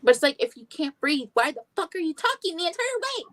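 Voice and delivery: rising, exasperated rant on the why. Real, not acted.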